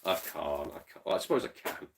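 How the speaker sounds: ordinary talk, nothing marked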